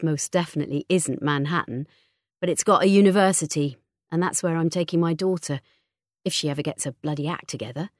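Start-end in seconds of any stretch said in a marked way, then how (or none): none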